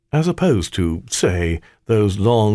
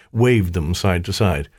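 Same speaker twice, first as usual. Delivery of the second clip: ordinary delivery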